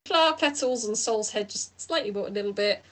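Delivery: normal talking voice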